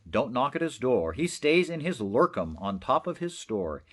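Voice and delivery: plain reading voice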